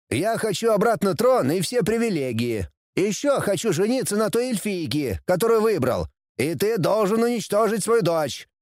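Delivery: put-on high-pitched, insistent young heir; bratty prince, demanding. A narrator's reading. Prince lists his demands. (капризный принц, высоким голосом)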